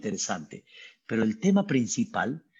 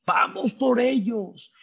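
high, slow yelled commands of the pursuing army; hammed-up shouts of the Egyptian troops; mock battle cry; 10 semitones higher, slower than usual. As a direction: call out the attack like a charging soldier. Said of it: "con los gritos".

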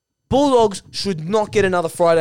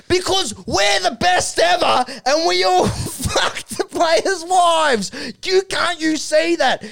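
high-pitched mock outburst; Duck, worked-up; 9 semitones higher than usual. Veteran confessing to the young player, high hammed-up outburst. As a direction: wail it high, drawing out the end. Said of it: "And he says".

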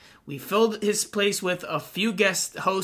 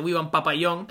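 Papayón said the Spanish way, pa-pa-YON with a y sound; mock Spanish accent reading Papayón; a light Spanish-accented Papayón. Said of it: "comes off with a Spanish accent".

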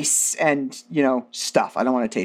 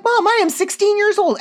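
much higher, a bit louder whiny protest; exasperated protest; 4 dB louder than usual. Retold or imitated. Daughter to her mom on the phone, high exasperated whine. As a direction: protest like a scandalized teenager.

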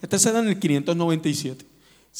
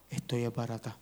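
quieter lament; 4 semitones lower, 11 dB quieter than usual. Shown as a demonstration. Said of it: "si estoy esbaratado".

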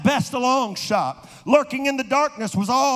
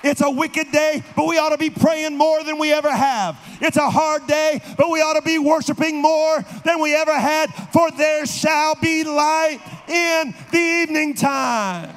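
preaching at full force; 4 semitones higher than usual. Acted. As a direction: bring it home, shouting. Climax of preaching.

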